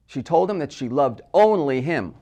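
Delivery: closing "only him" punched loud and lifted; stress on ONLY HIM. Shown as a demonstration. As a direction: land hard on "only him" at the end. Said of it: "Placing the word only".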